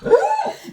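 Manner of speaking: sudden high laugh; high burst of laughter. Real, not acted. Laughing at her own rant about the family vault, high.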